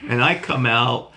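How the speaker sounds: usual speaking voice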